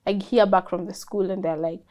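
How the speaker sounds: usual speaking voice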